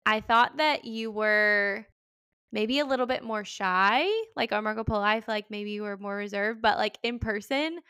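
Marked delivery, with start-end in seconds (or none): none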